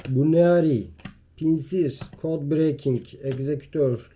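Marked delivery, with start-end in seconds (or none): none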